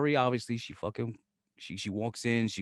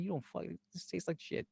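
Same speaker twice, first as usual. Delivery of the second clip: much softer, muttered complaint; disgusted aside; 5 semitones higher, 13 dB quieter than usual. Retold or imitated. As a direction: grumble it under your breath, disgusted. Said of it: "I'm like".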